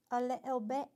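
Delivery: a lightly tried British accent on "a little bit"; a playful attempt at British English. Shown as a demonstration. Streamer trying out a British accent for chat. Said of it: "cringing in British".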